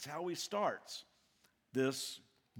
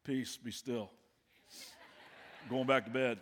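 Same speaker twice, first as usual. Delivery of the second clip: sleepy, unbothered; 3 semitones lower than usual. Acted. Just-woken Jesus, unbothered.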